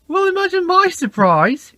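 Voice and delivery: high, loud eager cut-in; interrupting James. A narrator's reading. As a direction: jump in over the others. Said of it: "interrupted James".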